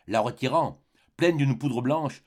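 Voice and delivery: plain reading voice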